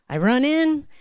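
ordinary talk

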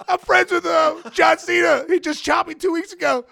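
high, loud pleading; mock frantic protest. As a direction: plead your case to a guard, hammed up. Retells pleading with security guard.

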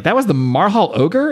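mock surprise, pitch lifted on the question; kinda surprised. Retelling Mirth seeing the Marhal ogre, mildly surprised. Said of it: "even Mirth is kinda surprised".

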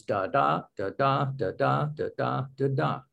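soft-spoken da DA pairs, stress on each second da; measured, even da da beat, rising-falling like a heartbeat; iambic rhythm. Shown as a demonstration. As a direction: tap out five feet, weak da then strong da. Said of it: "Each foot in those five is".

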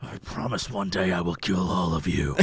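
low, quiet villain threat; menacing low voice. Retold or imitated. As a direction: growl it like a movie villain. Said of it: "instead you're just like".

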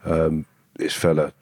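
ordinary delivery

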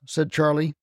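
usual speaking voice

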